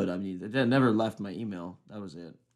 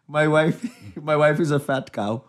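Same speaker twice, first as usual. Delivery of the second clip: mock Indian accent, raised and amused; comic East Indian accent breaking into laughs; 3 semitones higher, 3 dB louder than usual. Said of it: "a East Indian accent".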